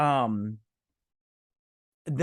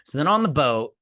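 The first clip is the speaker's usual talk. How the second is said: ordinary talk, nothing marked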